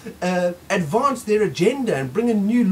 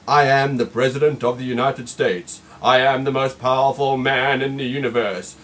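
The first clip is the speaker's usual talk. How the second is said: deep booming mock leader; pompous president; 7 semitones lower, 4 dB louder than usual. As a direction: boom it out like an almighty ruler. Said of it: "he'll say".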